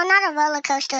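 ordinary delivery

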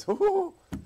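very high imitated hoots of glee; high-pitched joyful hoo-hoos; happy whooping. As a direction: whoop like a delighted old man. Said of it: "Henry started to get happy".

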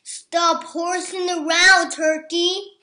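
a loud, teasing farm cow; scolding cow. A narrator's reading. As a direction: tease him loudly.

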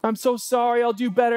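high pleading please repeated; mock desperate pleading; begging. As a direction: beg for forgiveness, pitched well up. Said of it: "you'll beg and beg".

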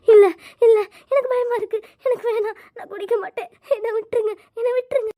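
high tearful begging through sobs; crying and pleading. Acted. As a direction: cry as you beg them to let go. Forced to drink, crying. (அழுதுகொண்டே கெஞ்சுதல்)

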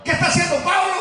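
loud preacher call and response; call to congregation. Acted. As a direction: rouse the crowd to shout back.

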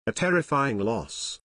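ordinary delivery

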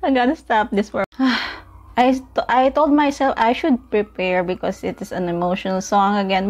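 ordinary talk

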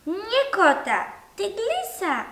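put-on high, loud little-boy call; child calling out. A narrator's reading. Little brother excitedly calling out at breakfast. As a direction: call it out like an eager small boy. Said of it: "zawołał".

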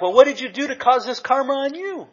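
high, loud comeback; retold yelled retort. Answering mom's screamed karma threat at the beach, retold loud retort. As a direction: fire the question back at a mock yell. Yelling back in retold quarrel.

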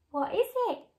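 lightly anxious little question. Acted. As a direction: ask it with mild alarm. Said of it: "asked the snail anxiously".

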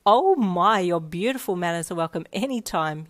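loud, gushing burst of praise; beaming delight. Acted. Mum delighted by Ichiro's manners, gushing loudly. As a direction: gush over his manners. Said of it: "your mum beamed".

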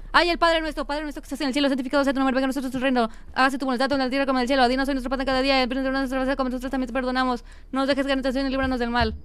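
hurried, monotone nervous praying; rattled-off prayer. Pretend. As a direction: race through it without stopping for breath. Panicked prayer while hiding.

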